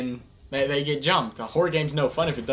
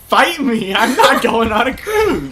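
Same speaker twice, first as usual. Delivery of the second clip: loud, high-pitched challenge; indignant, laughing outburst; defiant yell; 8 semitones higher, 8 dB louder, slower than usual. Real, not acted. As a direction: shout it back in playful defiance. Playful argument, refusing.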